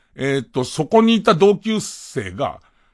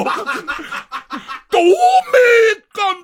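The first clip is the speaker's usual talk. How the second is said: very high, stretched-out holler; mock heckle; 12 semitones higher than usual. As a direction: ham up the yell, dragging every syllable. Exaggerated repeat of the yell.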